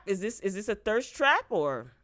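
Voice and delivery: ordinary talk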